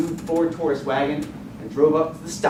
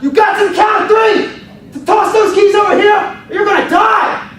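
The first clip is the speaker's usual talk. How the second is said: high, very loud countdown yell; yelling threat; 11 dB louder than usual. Retold or imitated. Carjacker counts down to threaten the teens, furious yell. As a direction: scream the countdown at them. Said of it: "Homeboy yells out".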